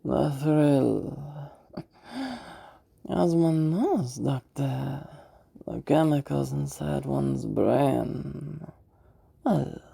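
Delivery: slow feminine voice, stretching the important words; feminine villain voice. Shown as a demonstration. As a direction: toy with the listener in a dragged-out feminine voice. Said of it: "I'm gonna be using a feminine voice".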